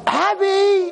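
high, loud cry of a name; retold joyful yell; excited shout. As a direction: call out in delighted recognition. Retold joyful shout on recognizing her.

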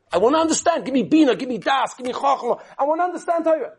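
high, eager plea for understanding; a student's high, longing cry to grasp Torah; pleading prayer. Retold or imitated. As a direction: beg God to let you understand.

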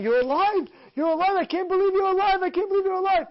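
retold cries of astonished relief; overjoyed disbelief. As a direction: cry it out in repeated disbelief. Acting out rescuer's joyful disbelief.

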